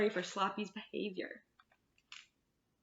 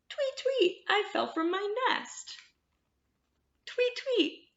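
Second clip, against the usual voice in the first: very high chirpy bird voice, a bit louder; little bird voice; 12 semitones higher, 6 dB louder, slower than usual. Acted. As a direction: chirp like a tiny bird calling for help. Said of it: "this little bird says".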